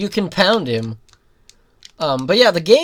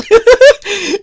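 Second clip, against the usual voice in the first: loud high laugh; cracking up at his own joke; burst of laughter; 13 dB louder than usual. Real, not acted. Gamer laughing at his own comment request.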